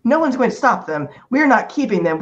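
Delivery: normal talking voice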